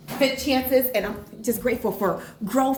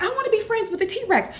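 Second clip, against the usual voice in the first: high-pitched imitation of an eager tot; lightly voiced child, pitched up; imitating small child; 12 semitones higher than usual. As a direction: play the little one announcing a wish. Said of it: "she was like".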